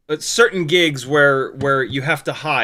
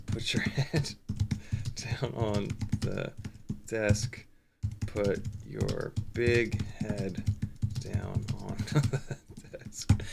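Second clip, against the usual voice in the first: soft, slow, much lower, breaking into laughs; laughing quiet quote; 7 semitones lower, 12 dB quieter, much slower than usual. Retold or imitated. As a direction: mutter it while cracking up.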